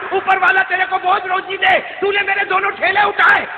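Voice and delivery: high, forceful preacher's declamation; impassioned preaching. Acted. Preacher retelling an old woman's blessings behind the Prophet, impassioned.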